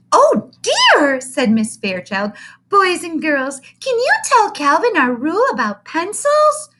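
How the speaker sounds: loud, high, slow gushing coo; gushing teacher. Acted. Teacher coos at the big boy, loud and high. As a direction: overdo the sweetness like a doting teacher.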